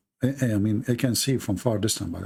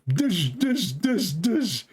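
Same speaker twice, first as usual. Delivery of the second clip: mock explosion noises; 7 semitones higher, much slower than usual. Explosion noises after dynamite joke.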